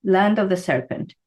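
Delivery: ordinary talk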